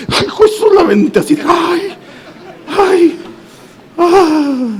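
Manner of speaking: high yelps of alarm, half laughing; startled fright. Retold or imitated. Seeing the rod whip by live cables, frightened yelps. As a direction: squeal as if danger just clicked.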